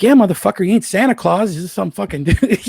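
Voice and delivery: loud, high, laughing boast; retold brag. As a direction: shout it up high like you are proud to know him.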